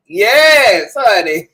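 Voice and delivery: loud, high sassy cheer; excited. Acted. Vlogger cheering herself on, loud and high. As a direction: hype yourself up, loud.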